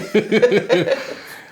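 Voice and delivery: big high burst of laughter; loud laugh. Real, not acted. Husband cracking up at wife's grown-folks joke, loud high laugh.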